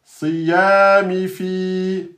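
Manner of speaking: "siyami fi" very slowly, "fi" held two beats. Shown as a demonstration. Teacher shows vowel length to the class. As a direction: stretch "fi" over two beats. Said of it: "là on a deux temps".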